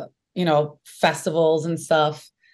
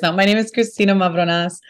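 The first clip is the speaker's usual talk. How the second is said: ordinary talk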